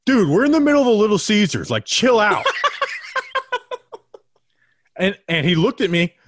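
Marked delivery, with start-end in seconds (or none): 0.0-1.7 loud retold line. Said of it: "I was like". 1.7-2.4 loud, high retold call-out; telling him off. Podcaster retelling telling off a yelling man, loud and high. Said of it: "finally I was like". 2.5-4.2 high laugh. Real, not acted. Laugh after the story.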